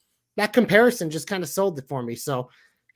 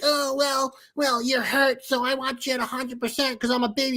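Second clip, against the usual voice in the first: put-on higher, whiny babyface voice; mocking impression; 6 semitones higher than usual. Podcaster mocking Sammy turning down a title shot, in a higher, whiny voice. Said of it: "Sammy being like".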